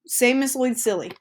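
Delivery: normal talking voice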